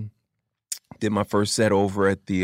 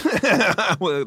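laughing; 12 semitones higher, 4 dB louder than usual. Real, not acted. Laughing while retelling the bombing.

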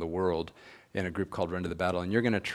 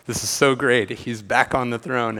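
mock celebration; 4 semitones higher, 9 dB louder than usual. Mentor's sarcastic mock celebration.

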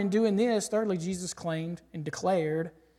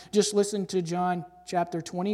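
usual speaking voice

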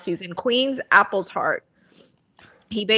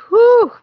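sharp loud whew from the burn; wasabi gasp; 11 dB louder than usual. Real, not acted. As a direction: blow out the wasabi heat. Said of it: "got my eyes tearing".

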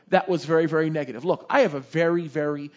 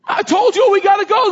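high, very loud complaint; exasperated outburst; 13 semitones higher, 10 dB louder than usual. Acted. Speaker acting out the talking donkey story, exasperated. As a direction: wail it loudly in protest.

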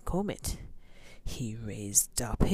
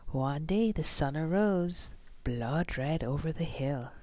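usual speaking voice

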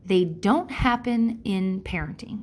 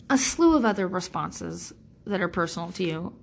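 ordinary delivery

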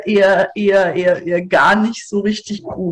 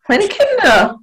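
put-on drunken cry; 12 semitones higher, slower than usual. Mother cries out for kids.

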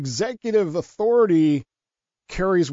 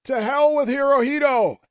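mock yell; 10 semitones higher than usual. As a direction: yell it from the hilltop. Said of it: "I yelled at the top of my voice".

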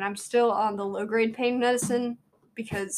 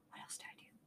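whispered to herself; 28 dB quieter, faster than usual. Real, not acted. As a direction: breathe it out under the breath. Unvoiced aside after a sigh.

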